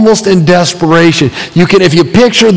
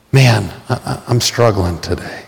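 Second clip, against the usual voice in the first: quiet confession; 8 semitones lower, 8 dB quieter than usual. Acted. Honest confession of struggling.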